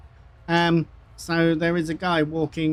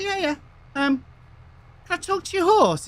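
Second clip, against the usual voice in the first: lightly voiced, much higher visitor; a hint of a high-voiced joke character; 11 semitones higher than usual. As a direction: pitch it up as the asking visitor.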